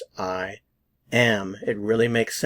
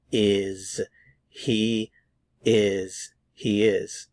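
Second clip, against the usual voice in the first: normal talking voice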